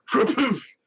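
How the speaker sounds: 공이 글러브에 꽂히는 소리 흉내; 퍽 퍽 짧게 끊어서; imitated ball thuds. Imitating ball hitting glove.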